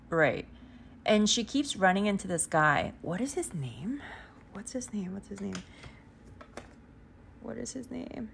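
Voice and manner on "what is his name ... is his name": quiet self-directed mutter; muttering to herself. Real, not acted. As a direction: mumble it under your breath while you try to remember. Trying to recall a name.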